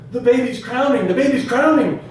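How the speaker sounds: high, loud announcement shouted across the room; excited yell. Retold or imitated. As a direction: yell the news out urgently. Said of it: "someone yells".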